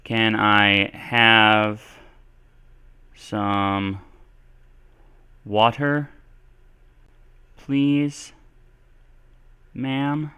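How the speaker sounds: word by word, long pauses between; slow dictation. Shown as a demonstration. Teacher showing polite request to learners, slow dictation. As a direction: spell out the example at writing speed.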